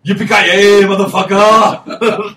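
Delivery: high, loud, drawn-out catchphrase yell, laughing; triumphant shout. Retold or imitated. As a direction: belt the catchphrase, stretching it slowly. Quoting the hero's catchphrase.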